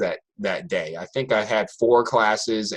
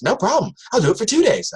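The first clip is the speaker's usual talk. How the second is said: put-on high, breezy teen girl voice, much higher; Brenda's cocky reply; 12 semitones higher, 4 dB louder than usual. Student Brenda breezily bargaining with her teacher, high teen voice. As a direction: chirp it back like a sassy student. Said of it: "She goes".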